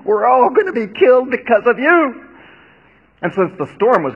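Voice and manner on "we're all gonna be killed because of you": much higher, louder despairing cry; terrified sailors blaming Jonah; anguished groan. A narrator's reading. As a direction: wail at him in dread. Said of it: "they groaned".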